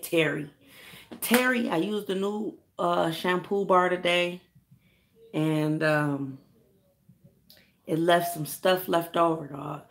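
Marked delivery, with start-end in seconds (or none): none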